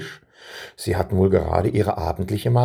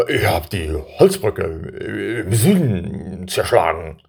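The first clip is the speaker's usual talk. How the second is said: put-on slow, self-satisfied older brother; drawn-out boastful voice; slow proud brother; 3 dB louder, slower than usual. A narrator's reading.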